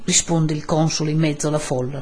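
ordinary delivery, nothing marked

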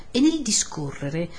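usual speaking voice

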